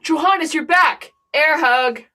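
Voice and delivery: high joyful cry; overjoyed. Acted. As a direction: greet him with delight. King overjoyed at return.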